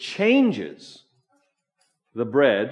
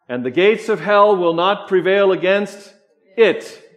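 loud, high reading, pausing before a punched "it"; stress on final "it"; 6 semitones higher, 8 dB louder than usual. Shown as a demonstration. Preacher pointing congregation to the last little word, stressed "it". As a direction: hold back after "against", then hit "it" alone. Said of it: "that last little word".

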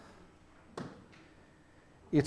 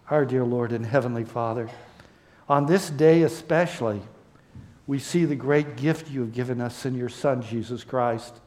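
closing prayer; 2 semitones lower than usual. Acted.

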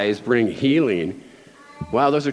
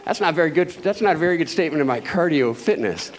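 ordinary delivery

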